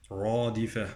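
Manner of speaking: dark back r opening "رادفة"; heavy ra. Shown as a demonstration. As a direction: say "رادفة" with a full-mouthed "rɑ". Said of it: "الراء مفخمة".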